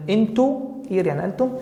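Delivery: ordinary talk, nothing marked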